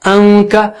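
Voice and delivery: انکا with a light held nasal n; انکا drawn out evenly; nasal ghunna. Shown as a demonstration. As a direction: hum through the nose before the ک of انکا. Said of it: "ناک سے غنہ کر رہا ہوں".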